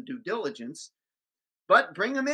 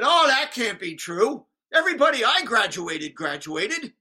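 put-on higher, louder incredulous old man; indignant disbelief; 5 semitones higher, 9 dB louder than usual. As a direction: scoff like he can't believe it. Old man's indignant denial retold.

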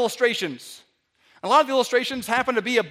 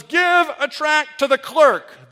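mock preacher; 5 semitones higher, 8 dB louder, slower than usual. Imitating sermon command.

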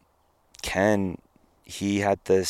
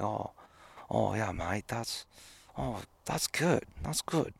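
put-on Aussie accent; 2 semitones higher, 10 dB quieter than usual. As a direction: murmur it like an Australian mate thinking aloud. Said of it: "I wouldn't do his accent in justice".

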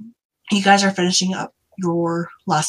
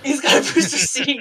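laughing it out, pitched up; 6 semitones higher than usual. Real, not acted. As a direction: giggle through the words. Laughing at tiny captain.

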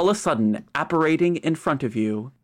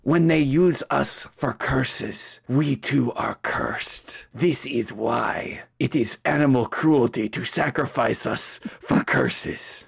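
put-on Spanish accent. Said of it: "[name] do a Spanish accent".

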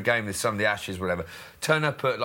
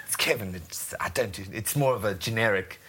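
put-on South African accent; 5 semitones lower than usual. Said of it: "generic South African".

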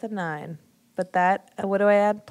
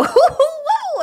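high, loud, laughing protest; laughing whoa; 10 dB louder, slower than usual. Acted. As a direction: back off while laughing. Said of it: "I go".

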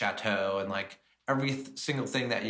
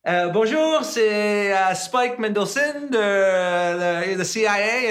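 put-on high, loud halting French with an American accent; nervous French attempt; 11 semitones higher, 9 dB louder than usual. Young cook phoning the French chateau, high, hesitant French. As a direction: stumble through French on the phone, high and loud. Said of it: "and I'd be like".

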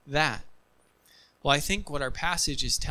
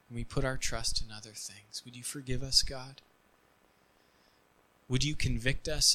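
hushed, earnest prayer; closing prayer. Acted. Pastor leads closing prayer.

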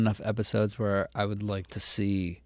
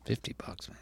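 whispering; 3 semitones lower, 15 dB quieter than usual. Acted. Whispered aside.

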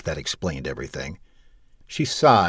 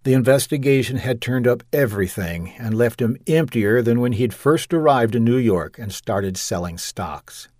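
ordinary delivery, nothing marked

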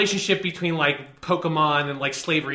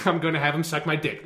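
normal talking voice

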